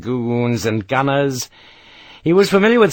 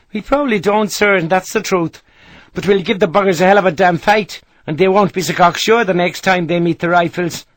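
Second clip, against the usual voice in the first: higher, louder Irish brogue, defiant; the Irish sergeant promising a hard fight; Irish soldier; 8 semitones higher, 4 dB louder than usual. Acted. Irish sergeant voiced by narrator.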